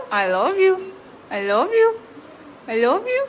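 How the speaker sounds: high loud loving coo; laughing coo. Real, not acted. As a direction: tell him you love him, laughing. Laughing, cooing love to bird.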